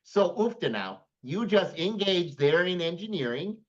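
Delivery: hammed-up Minnesota accent; playful mock-Minnesotan delivery. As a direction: lay on a folksy Minnesota accent. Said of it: "It's the Minnesota accent".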